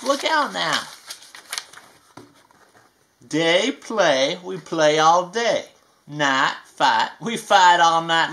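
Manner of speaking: slow, sing-song picture book reading; reading to children. A narrator's reading. Reading a rhyming book to kids, sing-song. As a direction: read each rhyme to a little one.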